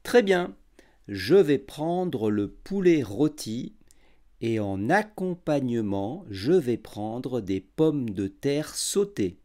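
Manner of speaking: every syllable clear and unhurried; over enunciating. Shown as a demonstration. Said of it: "j'articulerai bien et je parlerai lentement".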